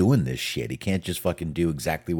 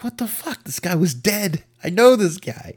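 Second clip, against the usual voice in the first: shocked outburst; 5 dB louder than usual. Retold or imitated. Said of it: "He's like".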